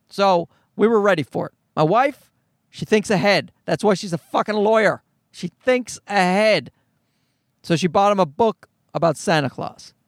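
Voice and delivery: ordinary delivery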